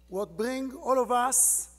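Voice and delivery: usual speaking voice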